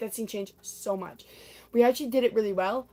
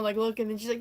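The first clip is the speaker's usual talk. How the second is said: ordinary delivery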